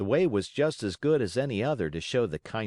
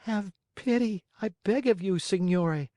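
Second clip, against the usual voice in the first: pitiful pleading; 11 semitones higher than usual. Acted. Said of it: "weeping bitterly, asked in a pitiful voice".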